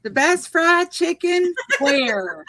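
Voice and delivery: drawn-out Southern fried chicken, slowed; Southern drawl. Shown as a demonstration. Asked to repeat it, she drawls the title for friends. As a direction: lean into the Kentucky drawl, slow. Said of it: "Say that fried chicken again, girl".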